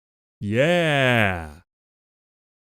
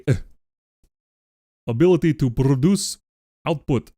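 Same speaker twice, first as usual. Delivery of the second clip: put-on Russian accent. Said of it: "let's do it in Russian".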